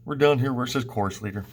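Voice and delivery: ordinary talk, nothing marked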